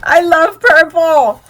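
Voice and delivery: very loud, drawn-out delighted cry; thrilled shout. Real, not acted. Thrilled by purple gift.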